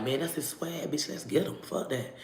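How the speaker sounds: hushed, near-whispered inner thought. Retold or imitated. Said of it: "So in my mind".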